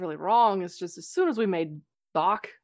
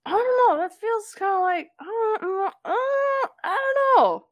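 flustered whine; 12 semitones higher than usual. Real, not acted. Flustered, near-crying repetition.

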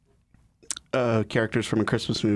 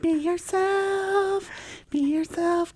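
silly high voice; much slower than usual. Acted.

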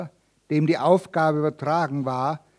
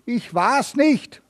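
higher, slow, pained groan; 7 semitones higher, 4 dB louder, slower than usual. Acted. Accused Michael denies the murder, groaning. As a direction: moan the denial, half pleading. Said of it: "stöhnte dieser".

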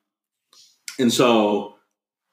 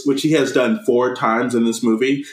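usual speaking voice

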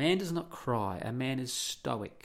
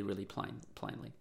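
ordinary delivery

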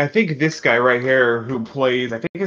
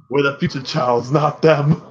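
dramatic Magneto quote; 5 dB louder than usual. Retold or imitated. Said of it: "I've seen that meme".

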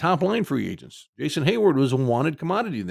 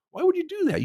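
why would you do that, incredulous and fed up; exasperated. Real, not acted. Fan ranting at the owners, incredulous and exasperated. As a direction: pitch leaps up in disbelief. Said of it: "frustrated and infuriated".

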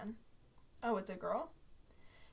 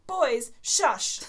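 annoyed mom; 3 semitones higher, 8 dB louder than usual. Acted. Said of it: "she complained".

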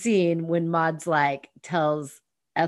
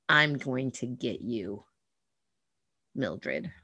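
low, soft, menacing threat; a villain child vowing revenge; 5 semitones lower, 6 dB quieter than usual. Retold or imitated. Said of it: "Ethel is now like".

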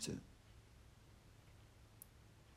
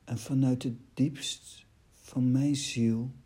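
declaimed love poem, key words pressed; poem recital; 2 semitones higher than usual. Acted. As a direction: recite your love poem line by line, giving each phrase weight. Reads own love poem aloud.

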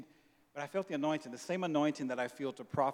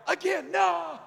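high, louder yelled refusal; retold yell; 7 dB louder, much slower than usual. Pastor acting the men yelling no again. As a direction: shout it up high.

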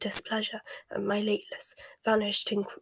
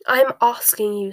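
louder, insistent interruption; insistent demand; 7 dB louder than usual. A narrator's reading. Bellatrix presses Snape for his own answer, insistent.

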